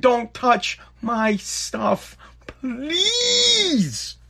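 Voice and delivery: slow, then a high fake-crying please; mock crying plea. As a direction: beg with a hammed-up whimper. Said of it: "I'm begging".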